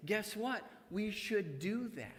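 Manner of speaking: ordinary talk, nothing marked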